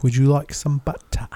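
"butter" with the T swallowed and the R dropped, buh-uh; mock-posh British offer of "butter". Shown as a demonstration. A host mocks British speech, saying "butter" without the T or R. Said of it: "Or it's butter".

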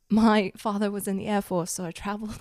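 put-on British backstory voice; British accent. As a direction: recite it in an assumed British accent. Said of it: "how I was raised in Cambridge".

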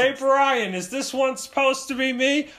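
high, loud dad imitation; exasperated dad. Dad demanding to see the drawings, loud mock exasperation. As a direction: badger your kid in a hammed-up dad voice.

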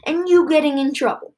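loud, high, angry command to leave; angry order. Acted. Miss Hartman ordering the children out, shouting angrily. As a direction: throw the children out, furious.

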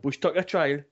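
lightly put-on Geordie accent. Shown as a demonstration. Co-host demonstrating the Bush Tucker trial line, Geordie accent. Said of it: "that Geordie accent".

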